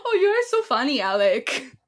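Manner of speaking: high, loud mock Spanish accent, laughing along; a hammed-up fake Spanish accent. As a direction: gush like a fake Spanish wife. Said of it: "making fun of a woman from Boston".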